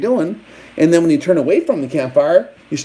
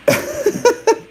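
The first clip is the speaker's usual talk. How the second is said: high laugh. Real, not acted. Laughs at own joke.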